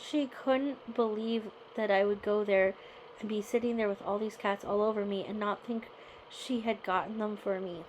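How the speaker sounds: ordinary delivery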